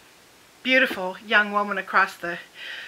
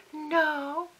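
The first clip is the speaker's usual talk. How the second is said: high, soft, syrupy Southern mother; butter-wouldn't-melt Southern innocence; sweet Southern voice; 6 semitones higher, 4 dB quieter than usual. Retold or imitated. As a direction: imitate a sugary Southern lady. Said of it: "so sweet butter wouldn't melt in her mouth Southern voice".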